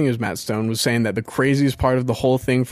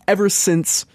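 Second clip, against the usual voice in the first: usual speaking voice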